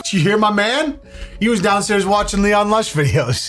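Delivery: high, gushing delight; high excited. Real, not acted. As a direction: gush like a fan. Gushing over the neighbour.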